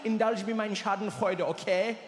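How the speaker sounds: put-on soothing German-accented friend; mock German accent, coaxing. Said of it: "He's like".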